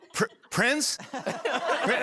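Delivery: high, tentative searching call; calling a dog. Retold or imitated. Host looks for vanished Prince, high calling voice. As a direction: call the name up high, questioning. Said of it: "like you're calling a dog".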